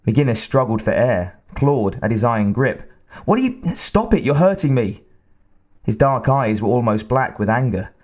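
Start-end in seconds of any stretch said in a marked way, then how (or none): 3.2-5.0 high, strained pleading; choked plea. A narrator's reading. Woman being choked begs her attacker to stop. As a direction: beg him to stop through the pain.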